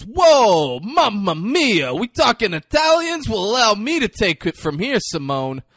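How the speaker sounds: Jimmy Garoppolo. Retold or imitated. Host parodies quarterback's Subway commercial, over-the-top Italian. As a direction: belt out a hammy Italian caricature. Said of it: "over the top".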